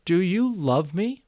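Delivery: put-on boy asking his parents, slow and high; embarrassed question. A narrator's reading. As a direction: force out the words despite embarrassment. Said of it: "flushed with embarrassment".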